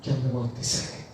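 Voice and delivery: said low and soft, not whispered; soft, low report. Retold or imitated. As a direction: quietly tell the news. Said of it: "y me dice". (dicho bajo, sin susurrar)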